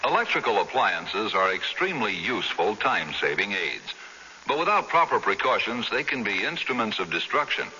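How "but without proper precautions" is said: ordinary talk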